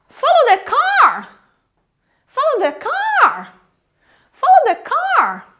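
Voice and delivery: high, slow call with "car" swooping up then falling; focus stress on car. Acted. Police officer in a chase to colleagues, focus stress on car. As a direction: punch "car" like an urgent police order. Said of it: "veja só, ele vai falar".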